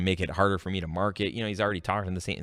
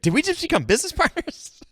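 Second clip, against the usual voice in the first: very high, laughing squeal of delight; high giddy laughing question; giddy movie quote; 6 dB louder than usual. Retold or imitated. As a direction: ham up the Step Brothers joy. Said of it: "Step Brothers, get the reference".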